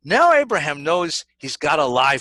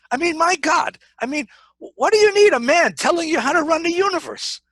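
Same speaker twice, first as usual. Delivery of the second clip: much higher, louder rant; exasperated; 13 semitones higher, 5 dB louder than usual. Acted. Preacher voicing God's annoyance at being told what to do, exasperated. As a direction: throw up your hands in mock outrage.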